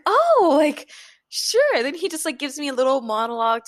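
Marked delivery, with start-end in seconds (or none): 0.0-1.8 surprised. Retold or imitated. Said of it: "I'm like".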